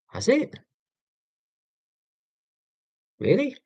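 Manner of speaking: high incredulous queries; surprised. Real, not acted. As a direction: sound genuinely taken aback. Surprised reaction.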